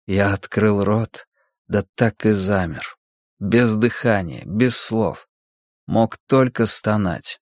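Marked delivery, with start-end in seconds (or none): none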